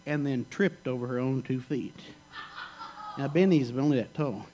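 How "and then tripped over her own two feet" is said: usual speaking voice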